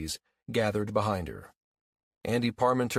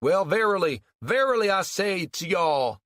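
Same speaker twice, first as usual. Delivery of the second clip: put-on southern accent; 9 semitones higher, 5 dB louder than usual. Street Jesus greets the narrator in a Southern preacher accent. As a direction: ham up a Southern revival preacher. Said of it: "caught his southern accent".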